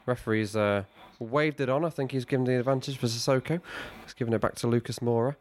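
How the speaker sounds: lively play-by-play commentator voice; mock commentary. Friend plays commentator over the match, live commentary. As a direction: call the match like a TV pundit.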